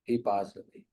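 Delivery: a hint of a widow weeping over her husband; lightly voiced widow answering why she cries; mild tearful widow. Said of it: "She'll say".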